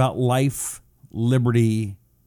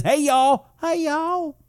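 much higher, squeaky Southern "Hey, y'all"; high-pitched mock folksy hello; chirpy greeting; slower than usual. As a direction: pop the greeting up high like a cheery Southern neighbor. Reading a viewer's greeting aloud.

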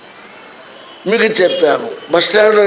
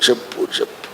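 urgent whisper; 12 dB quieter, faster than usual. Retold or imitated. The Spirit nudging the preacher to speak up, whispered. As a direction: whisper చెప్పు, చెప్పు as a hushed inner prompting. Holy Spirit urging him inwardly.

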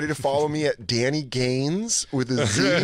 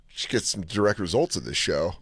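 usual speaking voice

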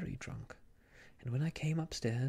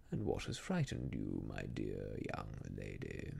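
low, softer, overdone coaxing croon; too coaxing; 5 dB quieter than usual. A narrator's reading. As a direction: coax her a little too sweetly. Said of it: "just a little too coaxing".

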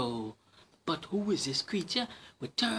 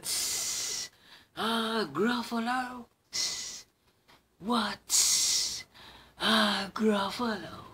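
lightly voiced hissing snake; a hint of snake hiss; 6 semitones higher, slower than usual. Snake asks the mouse what a Gruffalo is.

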